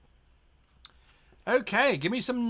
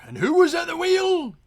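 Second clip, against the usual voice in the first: high, loud outraged captain; furious pirate captain demanding who was steering; outraged shout; 13 semitones higher, 9 dB louder than usual. Acted.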